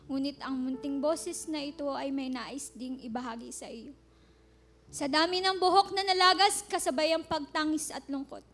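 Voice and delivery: loud, passionate poem recital; spoken word poetry. Acted. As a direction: declaim the poem with building fervor.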